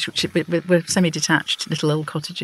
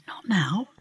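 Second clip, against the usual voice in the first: murmur; 2 semitones higher, 6 dB quieter than usual. Acted. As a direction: hush her discreetly.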